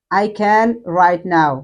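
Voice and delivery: very slow, can said in full; slow textbook read; slow full form. Shown as a demonstration. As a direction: say can and now clearly and slowly. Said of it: "সংক্ষেপে হচ্ছে".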